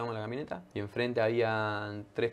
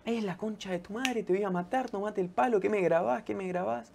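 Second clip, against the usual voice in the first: high, strained mock threat from a drunk stranger; imitating an angry stranger; 8 semitones higher, 3 dB quieter than usual. Retelling the drunk man who attacked him, high mock yell. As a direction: ham up the raving drunk's threats.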